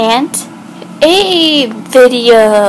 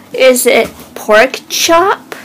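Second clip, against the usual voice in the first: ordinary delivery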